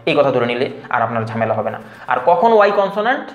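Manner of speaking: ordinary delivery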